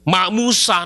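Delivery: ordinary talk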